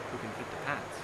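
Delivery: plain reading voice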